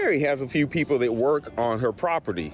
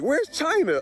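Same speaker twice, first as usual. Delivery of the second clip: worried question; 12 semitones higher than usual. Retold or imitated. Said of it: "they were like".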